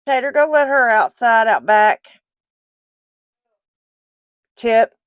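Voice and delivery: loud call across the house. Real, not acted. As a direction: call out to him in the back. Calling out to someone.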